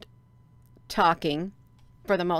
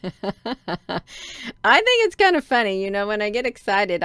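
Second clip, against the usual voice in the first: laughing; 5 dB louder, faster than usual. Real, not acted. Streamer amused at her own screen mishap, laughing. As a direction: burst out laughing as you speak.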